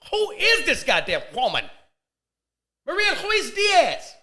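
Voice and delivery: loud, high-pitched exclamation; mock astonished outburst. Man amazed at the woman's offer, mock astonishment. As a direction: cry it out, stretching the name.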